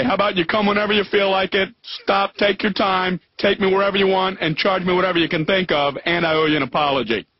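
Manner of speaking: ordinary delivery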